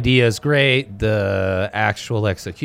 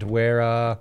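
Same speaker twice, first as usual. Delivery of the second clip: ordinary talk, nothing marked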